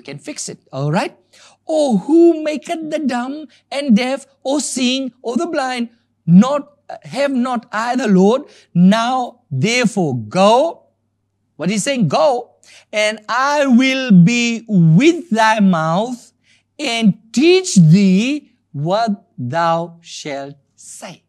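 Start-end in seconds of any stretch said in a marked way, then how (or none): 1.7-10.8 slow, high declamation with punched key words; scripture reading. Acted. Preacher reads the Lord's challenge to Moses, solemnly declaiming. 12.1-21.2 very slow, forceful proclamation with long pauses; scripture reading. Acted. Preacher reads the Lord's promise to Moses, declaiming slowly.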